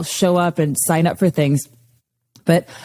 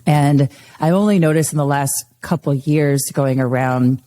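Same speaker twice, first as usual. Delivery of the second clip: ordinary talk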